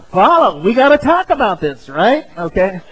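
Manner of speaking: high, loud mock-pleading woman's voice; imitating wife. Wife following husband after an argument, high insistent imitation. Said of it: "what does the woman do?".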